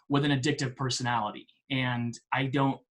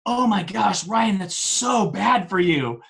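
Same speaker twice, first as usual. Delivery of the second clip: higher, stretched mock horror at a client's confession; retold shock; 5 semitones higher, 3 dB louder, slower than usual. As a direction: imitate a coach's wide-eyed alarm. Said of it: "his eyes got so big".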